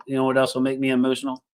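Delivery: ordinary talk, nothing marked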